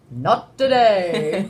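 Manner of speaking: very slow, stretched-out refusal; drawn-out catchphrase. Acted. Answering the God of Death question, drawn-out. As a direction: drag out each word theatrically.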